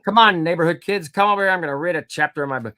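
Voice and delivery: high, loud mock call to the kids; hammed-up invitation to neighborhood kids. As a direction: author mocking reading his book aloud to kids. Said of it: "why would I read it out loud".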